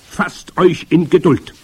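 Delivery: high, loud commanding witch; imperious witch leader; grand witch. A narrator's reading. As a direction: order the crowd in a high witch voice. Grand witch answering the crowd.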